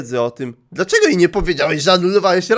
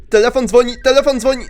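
startled alert; 7 semitones higher, 5 dB louder, faster than usual. Real, not acted. Nagły dzwonek telefonu.

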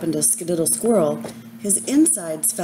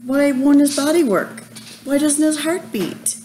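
high, loud, tearful why; crying out; 7 semitones higher, 3 dB louder than usual. A narrator's reading. Chester asks his mother through tears, crying out. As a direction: wail the questions through tears. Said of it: "he cried".

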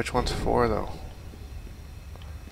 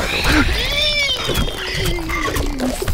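startled gasp; 7 dB louder than usual. Real, not acted. Spider jumps out in game.

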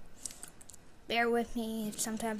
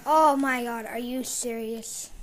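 frustrated exclamation; 9 dB louder, slower than usual. Real, not acted. Frustrated after losing a gunfight.

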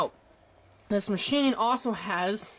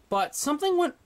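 hiccuping, words broken off; 7 semitones higher than usual. Real, not acted. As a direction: cut off by a hiccup. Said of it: "I'm burping and hiccuping at the same time".

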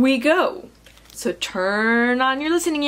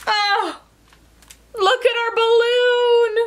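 high storybook alarm; dismayed cry; 10 semitones higher than usual. Acted. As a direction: cry out high as something escapes. Animals watch balloon fly off.

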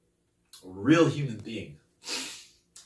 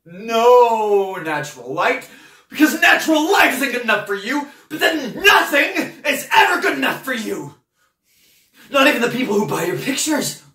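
high, loud, relentless shouting; full-volume exasperated outburst; furious tirade; 9 semitones higher, 10 dB louder than usual. Acted. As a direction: rage at someone who is never satisfied. Tirade at painter peaks.

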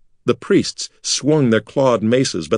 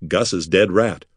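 usual speaking voice

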